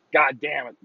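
normal talking voice

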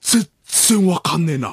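slow, drawn-out feigned bafflement; 伊集院光. Acted. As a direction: stretch it out as if utterly stumped. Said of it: "伊集院光さんが". (わざとらしく困る伊集院のものまね)

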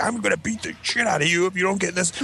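high-pitched hammed-up parental threat; exaggerated retold scolding; mock angry parent. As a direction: threaten the kid like dad did. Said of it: "I'm like my dad".

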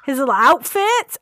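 put-on high, gushing over a cute kid. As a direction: coo like you want to pinch his cheeks. Gushing over cute child actor.

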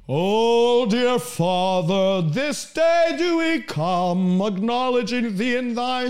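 slow, droning, drawn-out pious prayer; mock sanctimonious chant; religious scribe. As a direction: intone it like a pompous religious prayer, stretching the words. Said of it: "He didn't have that religious".

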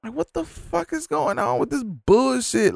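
high exasperated question. Retold or imitated. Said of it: "annoyed at slow cashier".

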